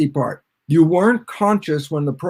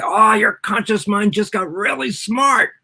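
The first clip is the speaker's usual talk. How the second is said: mock impressed; 6 semitones higher than usual. Acts out being impressed by learning.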